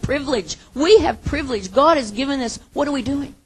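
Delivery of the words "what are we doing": pressing question. Acted. Repeated challenge to congregation.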